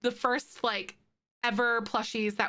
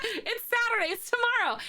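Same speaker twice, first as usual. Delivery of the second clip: much higher, bright realisation with a laugh in it; excited realisation; 13 semitones higher than usual. Real, not acted. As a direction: squeal it as the penny drops. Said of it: "I thought it was Friday".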